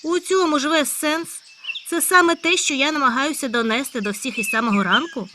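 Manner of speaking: high loud eager hare; a hare bursting with news. Acted. Hare character voice. (високий гучний схвильований заєць)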